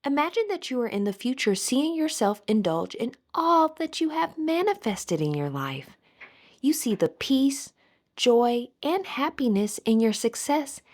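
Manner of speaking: usual speaking voice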